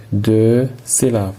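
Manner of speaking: ordinary talk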